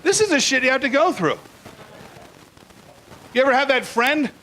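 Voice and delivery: ordinary talk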